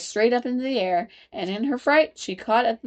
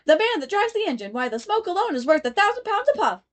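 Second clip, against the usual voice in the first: much higher chorus chant; chorus of voices; 11 semitones higher than usual. A narrator's reading. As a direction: chant it together like passengers. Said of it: "the chorus of voices went on".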